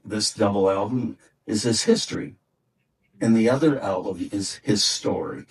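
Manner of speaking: ordinary delivery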